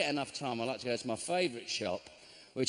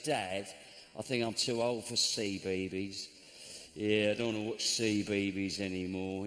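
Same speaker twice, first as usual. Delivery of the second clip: put-on lower, lisping kid voice saying C B B S; lightly voiced daughter lisping "C B B S" for CBBC; a hint of a lisping little girl; mock lisping daughter; 6 semitones lower than usual. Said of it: "she doesn't talk like that, by the way".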